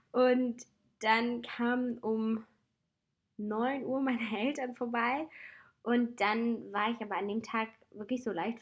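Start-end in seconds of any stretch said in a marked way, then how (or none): none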